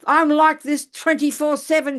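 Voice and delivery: high, loud, slowed-down complaint; retold scream. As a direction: imitate the old neighbor yelling. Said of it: "she's screaming at me".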